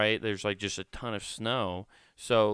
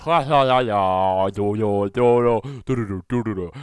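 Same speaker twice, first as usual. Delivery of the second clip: loud gagging sing-along; gagging theme song; 12 dB louder than usual. Pretend. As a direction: gag out the tune. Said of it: "Fox can't fucking get me on that one".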